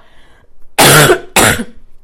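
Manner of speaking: harsh loud coughs; coughing fit. Real, not acted. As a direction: cough hard into the mic. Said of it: "Sorry about all the coughing".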